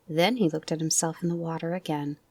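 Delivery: ordinary delivery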